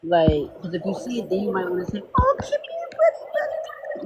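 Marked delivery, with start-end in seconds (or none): 2.2-4.0 very high, breathy coo at a dog; dog baby talk. Retold or imitated. A stranger cooing at the speaker's dog Kimmy, very high baby talk. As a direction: coo like greeting a cute puppy.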